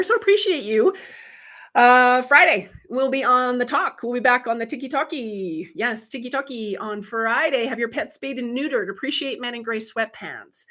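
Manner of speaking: ordinary delivery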